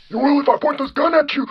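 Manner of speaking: put-on very high, threatening old-man voice; John Henry. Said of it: "you have the generic old man voice".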